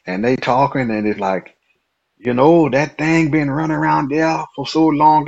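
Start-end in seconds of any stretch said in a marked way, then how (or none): 2.2-5.2 higher-pitched imitation of Cajun talk; Cajun accent. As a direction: imitate a Cajun local chatting. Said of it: "That's how they talk".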